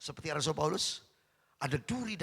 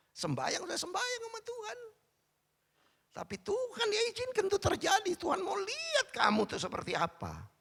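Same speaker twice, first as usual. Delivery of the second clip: much higher, fervent preacher's cry; preaching at full force; 5 dB louder than usual. Acted. Preacher crying out to the congregation about God's test, fervent and high. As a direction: lift it up like a revival preacher.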